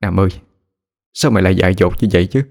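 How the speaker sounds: understated lament, pitch climbing toward the end; murmured grieving prayer. A narrator's reading. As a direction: plead with a dead friend, voice lifting in grief. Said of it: "rồi lẩm bẩm khấn".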